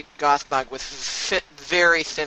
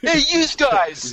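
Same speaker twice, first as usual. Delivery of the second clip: loud, high Brooklyn tough guy; Brooklyn accent; 10 semitones higher, 6 dB louder than usual. Pretend. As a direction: bark it like a Brooklyn wiseguy. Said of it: "with a Brooklyn accent".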